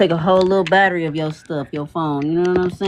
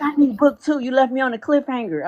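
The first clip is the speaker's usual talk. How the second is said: eager fan; 8 semitones higher than usual. Retold or imitated. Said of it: "They was like".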